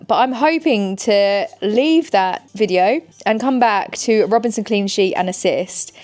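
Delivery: usual speaking voice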